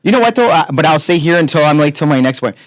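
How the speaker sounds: normal talking voice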